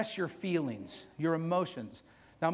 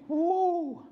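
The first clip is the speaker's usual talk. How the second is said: 1 awed woo; 8 semitones higher than usual. Real, not acted. Recalling a powerful movie.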